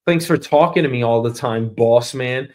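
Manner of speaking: ordinary talk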